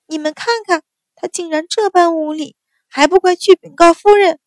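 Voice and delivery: high, rising into a loud yell; shouting. A narrator's reading. Sister shouting at maids to tell madam, high shrill yell. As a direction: shriek for the maids to fetch mother. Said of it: "嚷着说".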